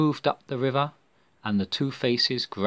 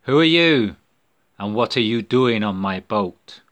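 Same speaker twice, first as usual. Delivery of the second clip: gruff challenge; 7 dB louder than usual. Acted. Heard a voice.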